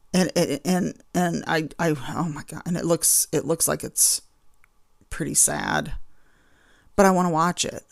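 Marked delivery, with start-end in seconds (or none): none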